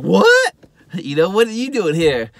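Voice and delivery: very high, loud squeal of surprise, then easing down; mock astonishment. McGarrett meets the girl that got away, astonished. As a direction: act out being stunned. Acts out shock at old flame.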